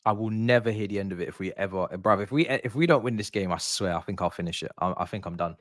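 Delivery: low, quiet, defeated grumble; resigned despair. Real, not acted. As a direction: mutter low, on the verge of giving up. Despairing as team may throw game.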